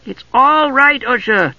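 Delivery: high, drawn-out kender call. A narrator's reading. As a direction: call reassurance across the room. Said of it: "Tass called".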